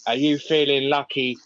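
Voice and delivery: mock gunslinger challenge. Gun on his hip, playing the gunslinger challenge. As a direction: deliver it like a showdown threat, pause before the last word. Acting out gunslinger movie line.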